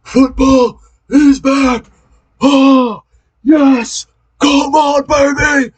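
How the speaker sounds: ecstatic shouting. Real, not acted. Ecstatic football celebration.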